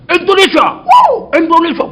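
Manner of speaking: loud, high excited exclamations; amazed delight. Retold or imitated. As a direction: imitate an astonished foreigner, loud and high. Chinese cook's amazed reaction acted.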